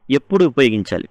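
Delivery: normal talking voice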